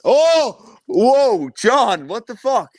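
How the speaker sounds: high, loud cry of shock; shocked exclamation. Real, not acted. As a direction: react as if he can't believe his eyes. Shocked at the fluffy hoodie.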